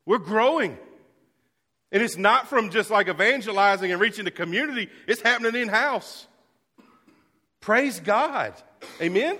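rally them with a loud high call to praise; full-force preaching. Real, not acted. Pastor shouting joyfully to his church, full-force preaching.

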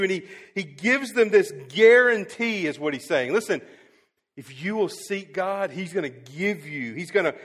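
loud, high, driving sermon voice; full-force preaching. Acted. Pastor confessing his confusion to the church, preaching loud. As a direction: preach it hard to the back row.

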